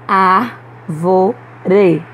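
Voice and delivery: "Árvore" in three separate syllables, strong Ár; stressed syllable demo. Shown as a demonstration. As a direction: break up "Árvore", leaning on the first syllable. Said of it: "há uma sílaba pronunciada com mais força". ("Árvore" silabado, com força em Ár)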